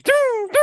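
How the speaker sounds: high-pitched sung-out "doo, doo"; cartoon sound effect. Fan joking about people fleeing the elevator, cartoon sound effect. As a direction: imitate a cartoon scurry noise. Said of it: "hear the, uh, cartoon".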